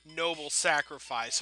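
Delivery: normal talking voice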